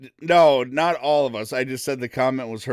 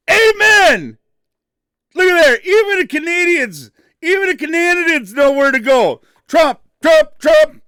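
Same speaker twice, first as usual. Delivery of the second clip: loud high hype shout for Trump; excited shout; 14 semitones higher, 12 dB louder than usual. Real, not acted. Host hyping a Canadian donor on air, shouting. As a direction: cheer it like a rally crowd.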